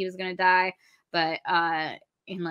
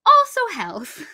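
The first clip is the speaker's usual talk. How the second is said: tacked-on afterthought; 8 semitones higher, 7 dB louder, slower than usual. Acted. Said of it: "She's like".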